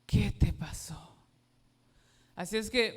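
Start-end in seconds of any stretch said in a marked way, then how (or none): 0.0-1.1 slow, breathy pitying voice; a hint of tearful mother; worried mother. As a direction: imitate a distressed mother. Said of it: "me dijo".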